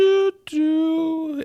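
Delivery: high, steady doo-doo fanfare; minion horn. Acted. Minions blasting in on the rocket car, high tooting. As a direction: toot it like a tiny car horn.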